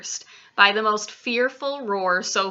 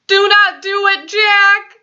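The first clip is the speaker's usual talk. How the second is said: high, loud pleading screech; 11 semitones higher, 11 dB louder, slower than usual. A narrator's reading. Dreaming giant pleading with Jack, terrified screech. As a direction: screech it in terror. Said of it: "he screeched".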